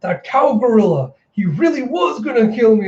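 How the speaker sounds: high mock-tearful outburst; hammed-up crying complaint; crying disbelief. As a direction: wail it in exaggerated hurt. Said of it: "Yamato's just crying with his exaggerated".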